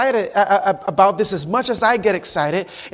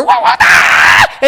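loud, long-held fighting yell; hammed-up martial-arts scream, drawn out; kung fu battle cry; 7 dB louder, much slower than usual. Jesus to Lucifer as a kung fu fighter, loud battle cry. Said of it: "Jesus said to Lucifer".

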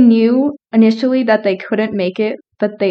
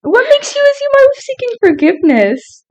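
mock offended; 14 semitones higher than usual.

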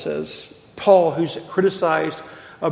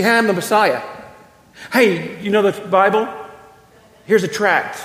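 pushy evangelist; 3 semitones higher, 3 dB louder than usual. Retold or imitated. Mimics a pushy street evangelist.

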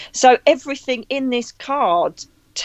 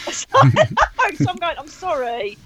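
laughing through an apology, high and slow; 7 semitones higher, much slower than usual. Real, not acted. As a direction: giggle while saying sorry. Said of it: "And I'm laughing".

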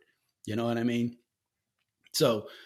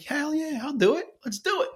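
excited; 3 dB louder than usual. Retold or imitated. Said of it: "And I'm like".

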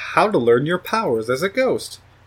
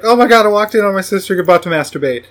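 high, loud, fast mock panic; freaked-out sister; 6 semitones higher, 5 dB louder, faster than usual. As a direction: blurt it out, horrified and rushed.

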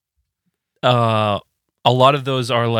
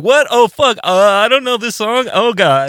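much higher, mock-frantic squeak; mock panic; 11 semitones higher, 3 dB louder than usual. Musician realising he doesn't know the song, high mock squeal. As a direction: squeal it in pretend dread.

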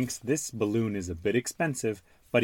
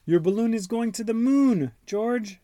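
put-on high, bright little girl voice; high Peppa voice; 12 semitones higher, 5 dB louder than usual. Peppa tells George where his balloon went, in a high voice. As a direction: voice Peppa teasing her brother. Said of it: "says Peppa".

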